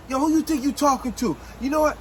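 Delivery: high-pitched, indignant telling-off; snapping angrily. Acted. Girl snapping at O'Neal over his remark, angry telling-off. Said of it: "now she snapped".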